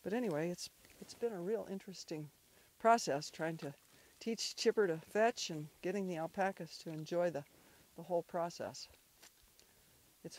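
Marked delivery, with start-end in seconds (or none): none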